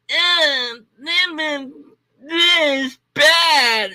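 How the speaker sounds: high, slow, stretched-out dismay; dismayed realization. Retold or imitated. Viewer realizing the debate is bad, high slow dismay.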